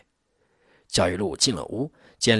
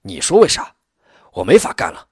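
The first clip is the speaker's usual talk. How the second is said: loud, indignant comeback; angry retort; 2 semitones higher, 9 dB louder than usual. A narrator's reading. Township chief quitting before the district head, angry retort. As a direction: throw the question back hard. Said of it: "李明反问".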